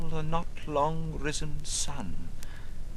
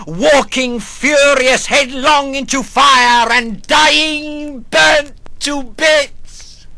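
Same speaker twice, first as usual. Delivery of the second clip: rising to a slow furious shout; furious outburst; 10 semitones higher, 10 dB louder, slower than usual. A narrator's reading. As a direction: hurl the words out in fury, drawn out. Narrator imagines furious death.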